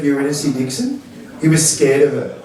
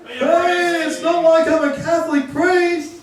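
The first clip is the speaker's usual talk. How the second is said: mock punchline voice; 11 semitones higher, slower than usual. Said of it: "What's the punchline?".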